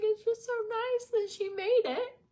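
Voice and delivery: retelling her own tearful words to her sister; crying voice. Retold or imitated. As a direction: replay the sob-talk from the restaurant. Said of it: "just crying".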